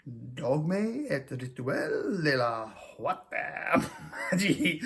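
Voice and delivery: slow, drifting mock-French accent that slides elsewhere; shaky foreign accent. Shown as a demonstration. Reading an occult book title, wobbling mock-French accent. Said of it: "I don't know what accent that started out with".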